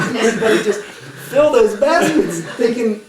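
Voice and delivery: loud, high, laughing through the telling; can barely hold the laughter as he tells it. Real, not acted. Retelling how the preacher drove off with the money, laughing loudly.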